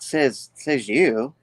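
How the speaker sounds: drawn-out, slow mocking retort; slow taunt. Spider-Man taunting the villains, slow and mocking. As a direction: stretch it out to needle them.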